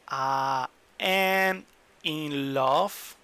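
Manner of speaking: very slow, drawn-out English model phrase; very slow demo. Shown as a demonstration. Teacher shows students the English phrase, very slow. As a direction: lay out each word slowly for learners. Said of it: "So look".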